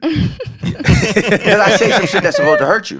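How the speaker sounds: slow, amused confession while laughing. Real, not acted. Laughing while admitting harsh words.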